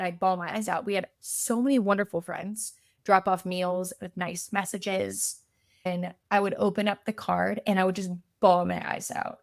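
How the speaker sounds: normal talking voice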